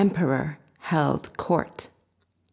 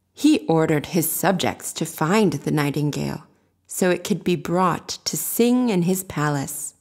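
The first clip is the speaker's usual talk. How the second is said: plain reading voice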